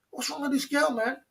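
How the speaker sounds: high, baffled exclamation; exasperated. Retold or imitated. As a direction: ask it at her in disbelief. Exasperated retelling of the girl.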